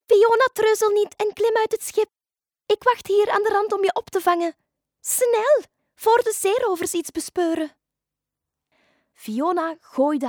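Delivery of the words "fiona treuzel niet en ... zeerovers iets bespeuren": high, loud urging; urgent dolphin. Acted. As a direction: hurry her along as a dolphin. (dringende, hoge dolfijnenstem)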